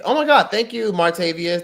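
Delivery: high, loud gasp of gratitude; excited thanks. Real, not acted. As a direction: sound delighted and surprised to be gifted. Thanking viewer for super chat.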